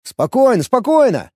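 high, hurried appeasing; alarmed placating. A narrator's reading. Player backing off from a towering warrior, alarmed. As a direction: try to calm him while backing away. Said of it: "попятился я".

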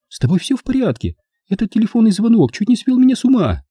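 high-pitched woman's role, worked up; agitated Louisa. A narrator's reading. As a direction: voice a rattled woman, pitched well up.

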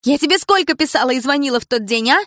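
high, loud accusing tirade; furious reproach. A narrator's reading. Dilara shouting at her former friend during the fight, furious. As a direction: hurl every betrayal at her.

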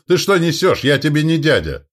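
high, loud indignant protest; indignant retort. A narrator's reading. Duke rejecting the word uncle, indignant.

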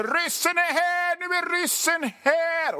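high, panicked cry that the Russians have come; hysterical prepper shouting. Retold or imitated. A military-obsessed prepper crying that the Russians are here, mock high panic. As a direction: squeal the alarm like a panicked prepper.

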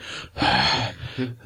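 acted winded, exasperated exhale; panting sigh. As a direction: heave a breath after running upstairs. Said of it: "I'm panting".

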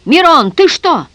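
high, loud, drawn-out call; slow, loud shout of disbelief; shouting across. A narrator's reading. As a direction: call out in alarm, stretching it. Rescuer shouts to the madman.

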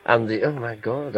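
ordinary delivery

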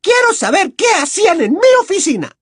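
high, loud villain command; sputtering foiled villain. Acted. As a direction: bark the order like a petty tyrant. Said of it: "dijo la profesora Umbridge".

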